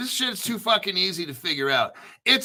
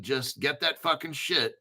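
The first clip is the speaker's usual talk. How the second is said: ordinary talk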